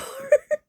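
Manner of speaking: short, loud, high burst; tearful laugh. Real, not acted. Speaker recalling crossed arms, sudden tearful laugh. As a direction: break up briefly, half laughing, half crying. Breaks up while recalling being scolded.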